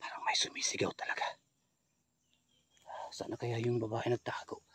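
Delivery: hushed whisper; tense whisper in the dark. Real, not acted. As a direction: keep it barely audible. Hushed ghost hunting aside.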